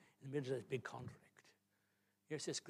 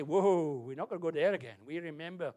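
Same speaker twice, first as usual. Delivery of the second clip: alarmed refusal; 3 semitones higher, 11 dB louder than usual. Acted. Voices enemies who should know better.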